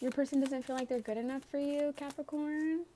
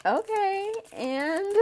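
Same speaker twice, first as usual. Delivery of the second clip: sassy; 10 semitones higher, 7 dB louder, slower than usual. Retold or imitated. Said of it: "You're like".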